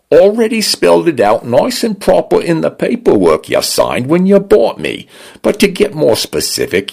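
the smart home lectures Dinah in a light Cockney-leaning British. Acted. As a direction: scold her like a know-it-all Cockney helper.

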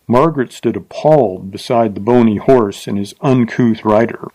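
plain reading voice

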